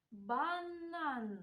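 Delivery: "банан" with both Ns held long; stretched-out "банан" for sound spotting; sound-highlighting pronunciation. Shown as a demonstration. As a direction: hum through each н in "банан". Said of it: "та відстежимо спільний звук".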